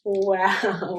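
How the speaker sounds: usual speaking voice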